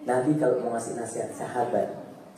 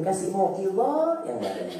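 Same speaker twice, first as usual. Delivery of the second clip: ordinary delivery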